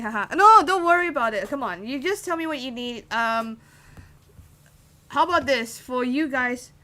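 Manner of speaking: ordinary talk, nothing marked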